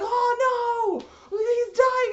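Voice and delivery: high squeaky mock panic; mock panicked friends. Friends wail uselessly over their dying friend, high mock panic. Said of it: "they're like".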